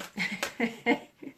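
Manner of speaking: short chuckle at a mistake; laugh at own slip. Real, not acted. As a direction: laugh off the mix-up. Laughs at mixing up the names.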